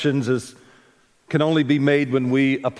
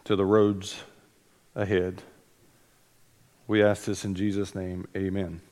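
prayer; 3 semitones lower than usual. Real, not acted. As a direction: pray gently over the congregation. Opening prayer before the sermon.

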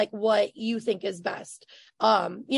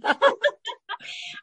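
big laugh; 12 semitones higher than usual. Real, not acted. Laughing at stalking remark.

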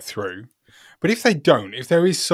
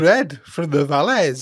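slow, raised Welsh singsong; mock Welsh accent; 4 semitones higher, 3 dB louder, slower than usual. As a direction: draw it out as a comic Valleys Welshman. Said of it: "in a Welsh accent".

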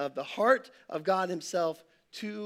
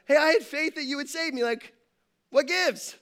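higher, hurt whine to God; disappointed complaint; 6 semitones higher than usual. Retold or imitated. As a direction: play up the letdown, fast and aggrieved. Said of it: "he's kinda disappointed".

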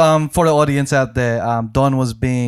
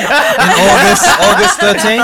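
announcer voice; 6 semitones higher, 9 dB louder than usual. Pretend. Mock release announcement.

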